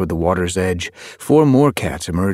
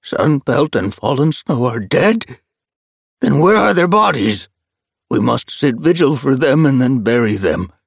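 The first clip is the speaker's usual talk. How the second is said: put-on higher, louder old tom, demanding; distraught elder; 8 semitones higher, 6 dB louder than usual. A narrator's reading. Grey elder demands where the bodies are, distraught. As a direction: insist on honouring the dead.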